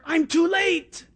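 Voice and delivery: high, slow, desperate cry; cry of despair. Acted. Blind beggar fears Jesus has passed, despairing cry. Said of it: "it was a cry of despair".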